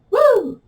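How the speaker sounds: high, loud "woo"; whoop of effort. Real, not acted. Teacher straining against the strap, high whoop.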